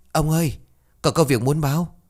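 servant bursting in with urgent news, calling out. Acted. Servant rushes in shouting news.

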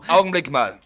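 loud, high cut-in; sharp interruption. Acted. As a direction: stop him mid-sentence.

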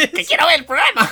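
high, very loud mock tantrum; hammed-up screamed demand; demanding ghost. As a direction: yell it as an outraged ghost, amused. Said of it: "el fantasma está tú en tu casa y te dice".

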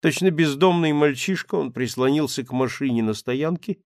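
usual speaking voice

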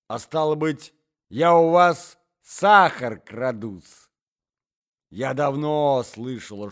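громкая обиженная отповедь, выше и громче; наступай на соперницу с обидой; сердитая отповедь. Acted. Ссора двух дам. (взбешённая дама кричит на Марью Александровну)

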